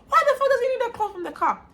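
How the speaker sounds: fast, high-pitched alarmed yell; retold shout. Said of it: "I legit shouted".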